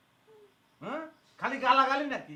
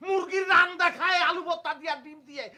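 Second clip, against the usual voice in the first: raised, heated rant; loud complaint; 3 semitones higher, 3 dB louder than usual. Acted. Son-in-law complains to wife about the meal, loudly. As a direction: rant with voice pitched up. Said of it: "আস্তে কথা বলেন না".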